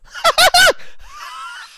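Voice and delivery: high, shrieking laugh; loud laughter. Real, not acted. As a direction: burst out laughing loudly at the joke. Reacts to Siri's joke.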